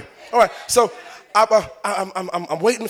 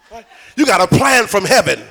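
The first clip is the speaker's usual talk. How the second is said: ordinary talk, nothing marked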